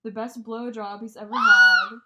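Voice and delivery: blurted out loud in disbelief; outraged shout. Real, not acted. To her friend about her ex, a loud outraged blurt. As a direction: practically yell the line.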